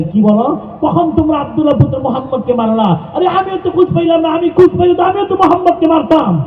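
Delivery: put-on high-pitched agitated young man; worked-up outcry, pitched far up; indignant protest. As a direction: protest in a high, rushed preacher's voice. Acted youth protests missing attack.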